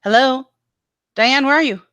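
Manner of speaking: high, searching call. Real, not acted. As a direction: call for a missing co-host. Calls for a dropped-out co-host.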